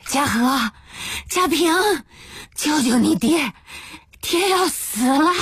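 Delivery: high, strained hoarse plea; hoarse crying out. Acted. Father calling his sons to save him, hoarse cries. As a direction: call out desperately through a hoarse throat. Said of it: "他嘶哑着嗓子叫道".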